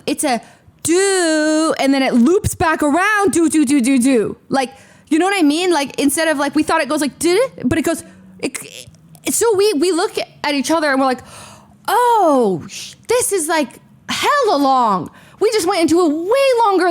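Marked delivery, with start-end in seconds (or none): 0.8-4.4 higher sing-song do, do, tracing a long winding line; mapping the zigzag queue in little sung notes; higher mock looping sounds. Shown as a demonstration. Tracing the queue for listeners in playful sung notes. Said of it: "to visualize it". 11.9-15.1 dismayed surprise. Retold or imitated. Realizing the line is huge.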